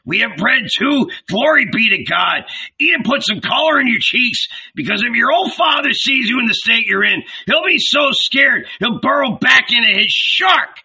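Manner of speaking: high, loud, hearty hail; booming mock-concerned host; boisterous old Zebedee. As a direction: bluster jovially, pitched well up. Zebedee, a character, hails Andrew.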